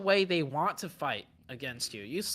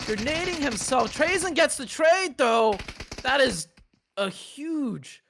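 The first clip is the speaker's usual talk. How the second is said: higher, louder, excited play-by-play burst; excited call; 7 semitones higher, 3 dB louder than usual. Real, not acted. Caster calling a surprise trade to the audience, excited and higher. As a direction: call the turnaround with rising excitement. Caster reacts to sudden fight swing.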